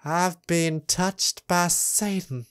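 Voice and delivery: slow, soft, haunted stare into the distance; staring emo girl. Acted. Imitating the emo girl staring into the distance, slow haunted voice. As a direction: drag it out softly as if possessed. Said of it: "staring into the distance like".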